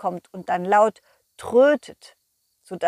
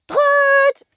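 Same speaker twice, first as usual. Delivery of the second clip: very high, loud trumpeting toot; 9 dB louder than usual. Acted. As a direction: blast it like a toy trumpet. Worm trumpets again.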